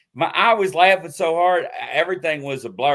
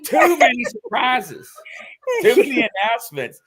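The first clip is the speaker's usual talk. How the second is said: high, loud, laughing words; laughing helplessly; 8 semitones higher, 5 dB louder than usual. Real, not acted. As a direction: wail it through real laughter. Still laughing after the slip.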